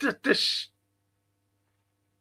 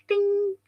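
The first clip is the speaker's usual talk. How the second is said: a high sung ding like a gleam off teeth; ping it out high like a twinkle sound effect; sparkle ding. Retold or imitated. Recalling Tony Curtis's grin, a high ding.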